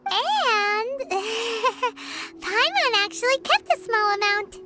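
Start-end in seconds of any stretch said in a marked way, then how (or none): 1.1-2.4 soft little giggle; sheepish giggle. Acted. Companion admits keeping some bait, sheepish giggle. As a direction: giggle softly to yourself.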